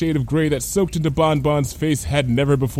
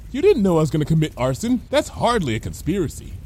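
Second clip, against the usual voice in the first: quick, higher-pitched rebuttal; high, blithe character voice; Lyra, breezy; 6 semitones higher, faster than usual. Acted. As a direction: argue back without concern. Lyra argues back.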